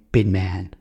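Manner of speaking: ordinary talk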